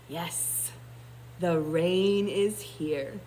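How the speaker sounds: low, slow purring lion; deep purr. A narrator's reading. Lion confirming the rain has come, deep purr. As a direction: rumble it slowly like a contented lion. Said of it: "spoke in a deep purr".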